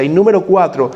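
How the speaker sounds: normal talking voice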